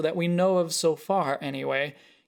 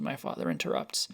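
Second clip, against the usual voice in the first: ordinary delivery, nothing marked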